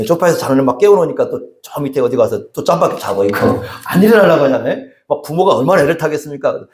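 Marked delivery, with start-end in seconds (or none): none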